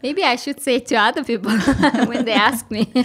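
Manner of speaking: words bubbling with real laughter; laughing while talking. Real, not acted. Podcast host laughing at her guest's witty reply, giggling through the words. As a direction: laugh through the line, amused by the answer.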